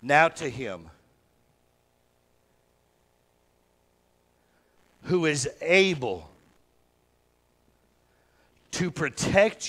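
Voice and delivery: slow, emphatic sections with long pauses; phrase by phrase. Shown as a demonstration. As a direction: give each phrase weight, then stop. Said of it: "take it in sections".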